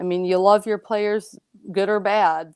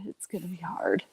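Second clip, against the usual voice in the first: fading to a whisper; hushed, choked-up whisper; emotional whisper; 2 semitones lower, 10 dB quieter than usual. Real, not acted. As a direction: let it drop to a tearful whisper. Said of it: "I'm getting all emotional".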